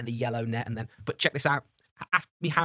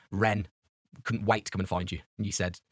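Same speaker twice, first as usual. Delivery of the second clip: normal talking voice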